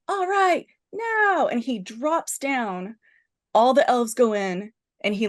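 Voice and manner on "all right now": much higher, acted call-out; retold signal call. Juso hanging on outside the helicopter, high-pitched acted signal call. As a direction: call the cue from up high, mock heroic. Hero calls the signal from above.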